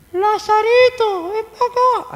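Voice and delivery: mocking a too-gentle summons; sweet coaxing call. Shown as a demonstration. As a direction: coax him out like calling a pet. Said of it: "Así no".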